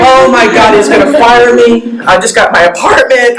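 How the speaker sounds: high-pitched mock panic; panicked anxiety. Employee fearing she'll be fired, anxious retold panic. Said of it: "having, like, anxiety".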